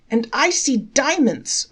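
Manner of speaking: a third elder calls out about diamonds; calling out. A narrator's reading. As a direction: cry out to top the others. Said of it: "a third called out".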